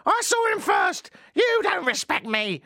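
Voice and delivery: high, slow hammed-up shriek; mock catfight shriek; mock screaming women. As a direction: squeal it like a drunk woman arguing. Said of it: "more along the lines of".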